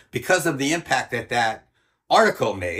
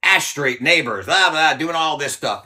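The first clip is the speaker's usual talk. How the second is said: slow, loud, higher headline voice; mock headline read; 3 semitones higher, 6 dB louder, slower than usual. Reading the newspaper headline aloud, slow and loud. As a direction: announce it like a front page banner. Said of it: "front page headline".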